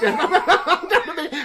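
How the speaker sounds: real helpless laughter; high laugh. Real, not acted. Laughing after listing her dildo, high laugh. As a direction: breaks up after the punchline.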